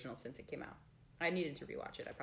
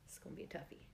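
whispering; 13 dB quieter, much faster than usual. Real, not acted. Whispered aside.

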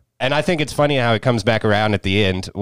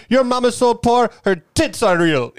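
high mock insult voice; 12 semitones higher than usual. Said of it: "Kal Penn gets her with".

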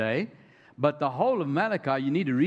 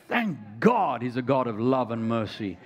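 normal talking voice

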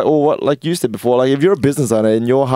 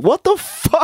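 much higher, disbelieving cry; mock shocked friend; slower than usual. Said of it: "They'd be like".

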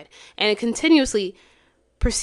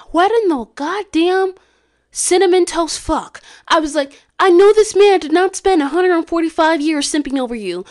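high, loud outraged rant; 8 semitones higher, 5 dB louder than usual. Retold or imitated. Reacting to Catherine's line, high loud outraged rant. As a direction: scream it in disbelief at the TV. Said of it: "because I was like".

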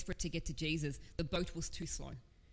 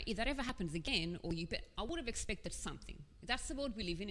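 usual speaking voice

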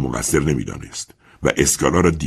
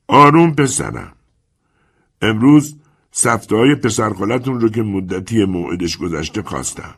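put-on a calm, sly old lender talking down to the young man; old moneylender Chiarenza; 6 semitones higher, 3 dB louder than usual. Old creditor Chiarenza voiced.

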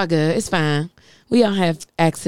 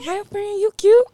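much higher, squealing praise for a friend; gushing; slower than usual. Real, not acted. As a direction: gush like you just saw your best friend. Gushing over friend Paige.

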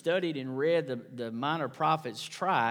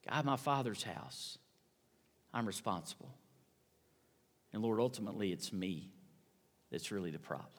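soft, low confession to God; hushed repentant prayer whispered to God; hushed humble prayer; 5 semitones lower, 6 dB quieter than usual. Acted. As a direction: pray it softly, owning the blame. Sinner's confession prayer voiced aloud.

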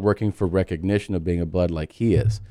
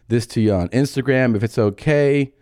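ordinary talk, nothing marked

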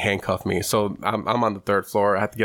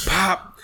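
higher, sharp pop; pop sound; 10 semitones higher than usual. Retold or imitated. Imitating the knuckle popping back in. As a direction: snap out the pop.

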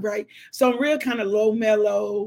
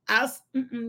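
usual speaking voice

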